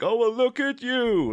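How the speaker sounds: high, drawn-out condescending coo; slow mock-patronizing greeting, pitched right up. Villain greeting Ivy as he comes in, condescending. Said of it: "it was all very condescending".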